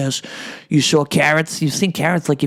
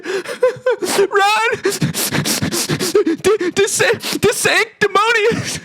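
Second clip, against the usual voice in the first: put-on high, slow sobbing child voice; crying little boy; much slower than usual. Little boy crying to Trump, high and sobbing. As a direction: blubber it out like a weeping kid.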